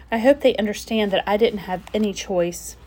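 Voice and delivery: ordinary delivery, nothing marked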